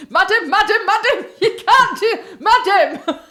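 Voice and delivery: high, loud official trying to stop someone; imitated airport-staff shout; urgent calling out. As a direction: call out repeatedly in high-pitched alarm, half laughing.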